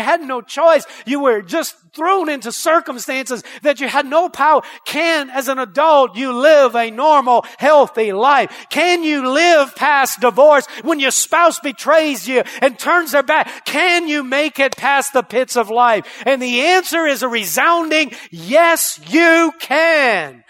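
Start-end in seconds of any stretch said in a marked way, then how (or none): 4.8-18.2 loud, rising sermon delivery; preacher at full cry; full-force preaching. Acted. 18.3-20.4 louder, ringing declaration; resounding yes. Acted. Resounding answer.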